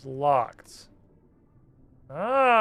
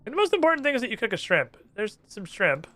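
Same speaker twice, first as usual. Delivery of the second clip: much higher, startled little voice; chirpy surprised underwater stranger; squeaky NPC; 7 semitones higher than usual. Acted. Trapped islander greets the first visitor, high squeaky.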